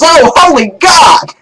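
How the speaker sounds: high, loud, slow yelp; jolted awake shout; startled scream. Retold or imitated. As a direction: cry out as if jabbed. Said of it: "he jumps up and screams".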